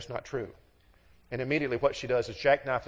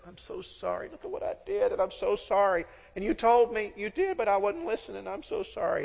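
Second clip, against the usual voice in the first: high, pleading imitation of a crying wife; mock tearful apology; 7 semitones higher than usual. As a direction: apologize as if close to tears. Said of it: "she's probably crying and she says".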